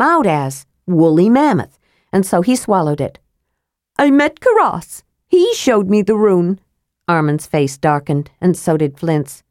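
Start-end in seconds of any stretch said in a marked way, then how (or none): 4.0-6.6 higher, eager kender voice; sly kender explaining the way to the rune; Tass the kender. Acted. Higher playful kender voice.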